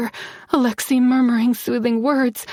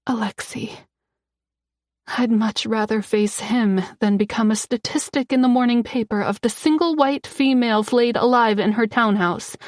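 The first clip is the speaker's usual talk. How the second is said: usual speaking voice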